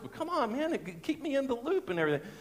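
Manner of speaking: high-pitched mock complaint; comic put-out whine; miffed protest. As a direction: plead your grievance in a squeaky-high register. Retells his miffed reply.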